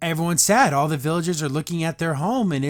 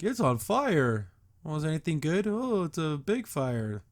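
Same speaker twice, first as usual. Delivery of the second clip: deadpan Swiftwind; 5 dB quieter than usual. Retold or imitated. Voicing Swiftwind's dull replies.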